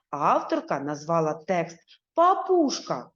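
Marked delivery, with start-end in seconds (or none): 2.1-3.1 Папушка said higher and tender. Shown as a demonstration. Teacher shows the fond name to pupils, tender. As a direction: coo Папушка affectionately. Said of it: "ніжно і ласкаво".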